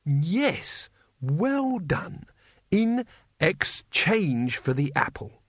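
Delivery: encouraging teacher. Acted. Mister Saveloy praises Cohen and sends him shopping, encouraging. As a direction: coach a slow pupil along patiently.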